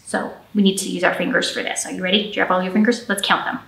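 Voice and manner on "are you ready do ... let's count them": usual speaking voice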